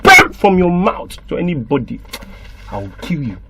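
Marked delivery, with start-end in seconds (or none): 2.7-3.4 much lower and softer, deadly quiet; menacing low threat. Acted. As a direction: drop the voice to a chilling hush. Uncle threatens the child.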